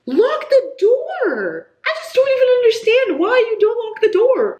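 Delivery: very high, loud protest; exasperated yell. Real, not acted. Reader scolding characters who never lock doors, exasperated high yell. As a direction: wail it at them in disbelief.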